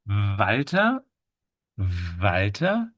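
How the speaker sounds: "Walter" as VAL-tuh, with a buzzing v; German W. Shown as a demonstration. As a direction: start "Walter" on a long voiced v. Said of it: "to use the v".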